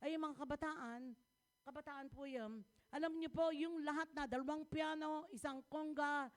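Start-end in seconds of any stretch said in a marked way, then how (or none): none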